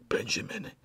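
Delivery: soft unvoiced whisper of a name; faint smile. Dying Blair to Benjamin, a whispered smile. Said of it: "he smiled".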